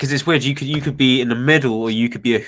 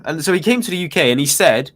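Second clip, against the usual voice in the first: usual speaking voice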